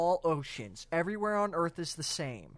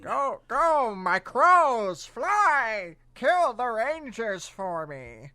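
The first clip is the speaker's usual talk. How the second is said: higher and louder, a cartoon villain barking orders; hammy villain command; 4 semitones higher, 7 dB louder, much slower than usual. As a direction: shout orders at your minions like a bad movie villain. Villain ordering crows, hammed up.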